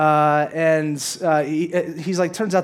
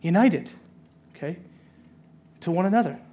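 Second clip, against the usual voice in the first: normal talking voice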